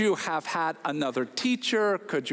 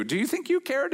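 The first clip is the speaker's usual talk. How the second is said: defensive retort; 10 semitones higher than usual. Retold or imitated.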